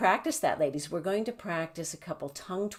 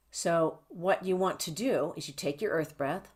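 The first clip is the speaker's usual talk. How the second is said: ordinary delivery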